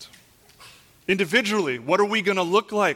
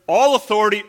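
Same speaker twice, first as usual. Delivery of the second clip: loud, raised voice punching the words; forceful preaching; 5 semitones higher, 10 dB louder than usual. Real, not acted. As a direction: proclaim it with full pulpit force. Repeats verse with more force.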